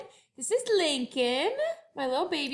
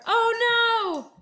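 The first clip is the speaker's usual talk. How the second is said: mock alarm; 7 semitones higher, 6 dB louder than usual. Alarmed at dinosaur.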